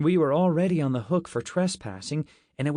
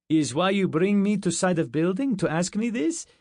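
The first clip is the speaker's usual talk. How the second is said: put-on higher, puzzled Russian accent; broken-English Russian asking why; Russian soldier questioning his commander; 8 semitones higher, 4 dB louder than usual. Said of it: "This one was Russian".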